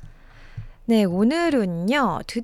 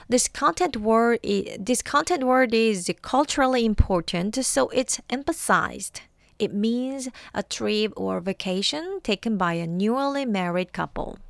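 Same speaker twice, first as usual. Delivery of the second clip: usual speaking voice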